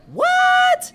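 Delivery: very high, loud squealed shout; sky-high exaggerated cry of surprise; mock amazement. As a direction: shriek it in hammed-up disbelief. Mock amazement at the exhibit he plugs.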